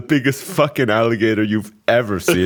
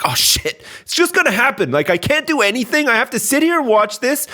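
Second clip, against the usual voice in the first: helpless dread; 7 semitones higher than usual. Retold or imitated. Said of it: "You're just like".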